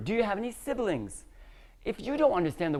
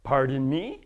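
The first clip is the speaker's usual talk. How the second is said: polite query; 8 semitones lower than usual. Shown as a demonstration. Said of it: "yes, you could do this".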